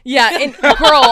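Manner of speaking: loud, drawn-out emphatic. Real, not acted. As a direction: stretch it out loud. Emphatic reply, loud and far.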